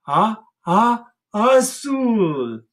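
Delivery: high loud sneezed azul; sneeze azul. Shown as a demonstration. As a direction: sneeze the word out like a dog. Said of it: "Can you do that?".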